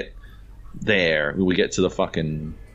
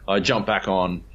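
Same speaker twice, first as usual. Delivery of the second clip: ordinary delivery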